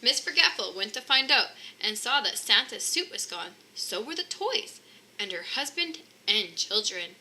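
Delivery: plain reading voice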